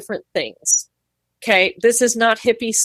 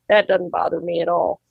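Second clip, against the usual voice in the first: usual speaking voice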